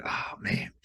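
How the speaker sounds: breathed "Oh man" in shock; stunned whisper. Retold or imitated. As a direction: say it under your breath, caught off guard. Stunned reaction retold.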